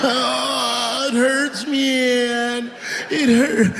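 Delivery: high whimpering cry of pain; hammed-up sobbing junkie; mock crying addict. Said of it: "stupid heroin last words".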